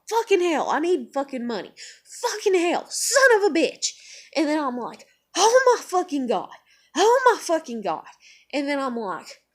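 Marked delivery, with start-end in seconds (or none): 0.1-3.9 higher, swelling cursing; exasperated outburst. Retold or imitated. Cursing over being stuck in the job, mounting exasperation. 5.4-8.1 loud, high cursing; exasperated outburst. Retold or imitated. Reliving her frustration at work, loud exasperated cry.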